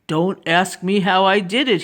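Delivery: lightly voiced older gentleman; Mister Carp. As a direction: deliver it as the knowing helper. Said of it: "he said".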